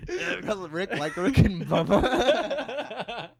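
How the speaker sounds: slow, loud words pushed out through laughter; laughing through it. Real, not acted. Co-host teasing about Rick and Bubba, laughing. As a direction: crack up while getting the words out.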